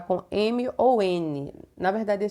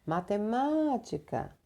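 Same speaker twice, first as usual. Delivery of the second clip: MATEMÁTICA called slowly and softly with a stretched MÁ; calling-out stress; 3 semitones higher, 5 dB quieter, slower than usual. Shown as a demonstration. Teacher testing pupils on the strongest syllable, MATEMÁTICA called with a long MÁ. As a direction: call MATEMÁTICA like a name, lingering on MÁ. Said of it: "como se estivesse chamando o nome de alguém".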